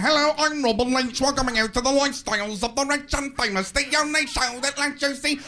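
screaming TV host, high and loud; hammed-up shouted celebrity-show intro; Robin Leach. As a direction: bellow it like an overblown show host. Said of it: "Robin Leach would come on screaming".

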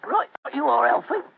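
weak put-on Cockney; a faint stab at Cockney; Cockney accent. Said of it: "My Cockney accent doesn't compare with yours".

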